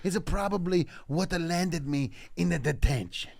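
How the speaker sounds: put-on Italian storyteller accent; sad Italian. Comic telling a boyhood detention story, mock Italian accent. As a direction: melodramatic Italian accent with extra vowels between words.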